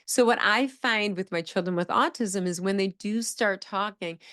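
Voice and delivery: ordinary talk, nothing marked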